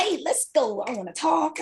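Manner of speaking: perky morning person. Retold or imitated. Waking her line sisters, chirpy and eager. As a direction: bounce in ready to chat. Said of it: "be like".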